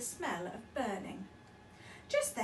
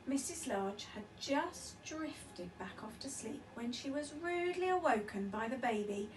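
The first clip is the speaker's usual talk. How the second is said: plain reading voice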